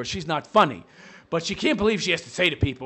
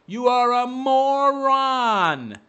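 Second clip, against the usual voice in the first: slow drawn-out insult; 4 semitones higher, much slower than usual. Acted. Repeated slowly, drawn out.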